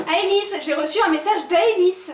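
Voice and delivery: loud, high repeat of the news; insistent shout. Acted. Daughter shouting the news at her mother, insistent. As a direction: make her mother finally listen.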